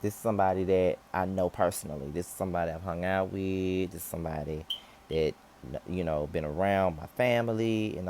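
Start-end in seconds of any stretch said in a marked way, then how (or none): none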